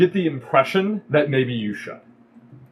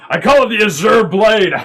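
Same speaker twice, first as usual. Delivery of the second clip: proud announcement; 7 semitones higher, 8 dB louder than usual. Acted. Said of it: "he goes".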